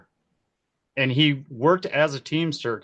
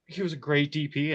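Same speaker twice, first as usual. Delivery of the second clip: usual speaking voice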